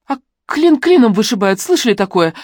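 резко, громче и выше; отрезать со злостью; зло отрезав. A narrator's reading. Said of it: "зло отрезала Лерон". (зло и резко)

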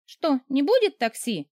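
высокий медленный ехидный вопрос; поддеть насмешливо и неспешно; ехидно. Acted. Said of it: "ехидно спросила я". (героиня ехидно поддевает начальника на крыльце)

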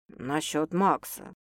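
low, soft, slow explanation; tender male voice; gentle. A narrator's reading. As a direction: explain softly to reassure her. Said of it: "мягко пояснил мужчина".

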